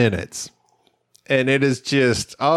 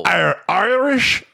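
mock Irish pirate; 6 semitones higher, much slower than usual. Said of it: "Aren't they all a little bit Irish?".

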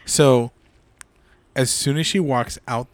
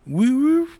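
high woo-woo siren noise; siren imitation; 13 semitones higher, 5 dB louder than usual. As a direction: mimic a police siren.

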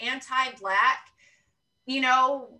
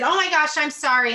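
flustered apology; 4 semitones higher, 9 dB louder than usual. Retold or imitated. Flustered apology on bumping into someone.

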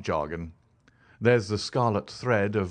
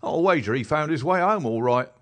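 higher, louder common London constable; a plain-spoken beat policeman telling his tale, pitched up; constable Rance; 7 semitones higher, 6 dB louder than usual. Acted. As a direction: policeman recounting what he found, higher and louder. Rance answers.

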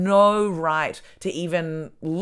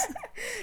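shrieking laugh up high; high squealing laugh; 14 dB quieter than usual. Real, not acted. Laughing at her own joke about the shitty town, squealing.

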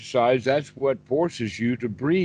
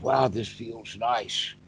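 slow and soft, savouring it; contented inner voice; 5 dB quieter, slower than usual. Retold or imitated. Teacher voices a meditator's pleased thought, slow soft contentment. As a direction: let it melt out like a quiet sigh of pleasure. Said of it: "the wholesome thoughts that you started to having in your practice".

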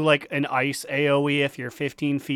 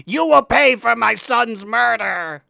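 mock villain boss voice; 5 semitones higher, 3 dB louder than usual. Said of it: "is like".

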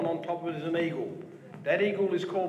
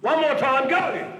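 loud high call; shouting; 8 semitones higher, 7 dB louder, slower than usual. Real, not acted. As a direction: push it out louder. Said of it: "Not, not loud enough".

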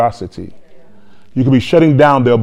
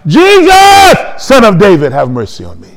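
high loud shout, then falling away; loud plea that drops by the end; crying out; 7 semitones higher, 5 dB louder than usual. Acted. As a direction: belt the name, settle toward speech. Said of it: "cry out".

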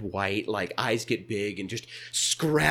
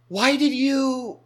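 put-on high, drawn-out kid voice; stunned kid trailing off; 14 semitones higher, 4 dB louder, much slower than usual. Startled kid to stranger in the stall, high trailing voice. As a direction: squeak it slowly and let it trail off. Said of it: "trails off".

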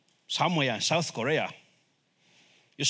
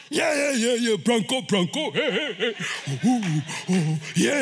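rowdy stadium chanting and whooping; cheering football fan; 4 semitones higher than usual. Acted. Football fans in the stadium, acted excited cheering. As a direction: cheer the team on with hoots and heys. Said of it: "you see people in the".

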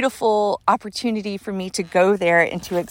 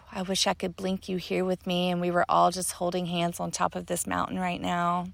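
ordinary talk

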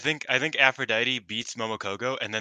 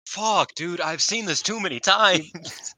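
exasperated; 7 semitones higher, 4 dB louder than usual. Real, not acted. Groaning at a repeat matchup.

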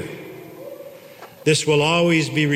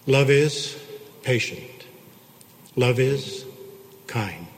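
soft, slowed reading of scripture; 2 semitones lower, 3 dB quieter than usual. Acted. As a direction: savour each line of the love chapter. Reads First Corinthians love chapter.

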